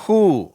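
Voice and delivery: HOO with a breathed h, no w; drawn-out model word ஹூ, starting on h; H sound demo. Shown as a demonstration. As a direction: say ஹூ slowly for learners. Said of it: "ஹ் என்ற உச்சரிப்பு".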